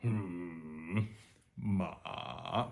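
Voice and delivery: dipping tone as a doubtful grumble; grumbling third tone. Shown as a demonstration. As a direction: grumble hmm ma like an unsure editor. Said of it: "grumbling at an idea".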